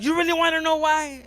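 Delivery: high, loud indignant question; worked-up. Acted. Asshole answers the crying heart, worked-up. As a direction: throw it back high and loud.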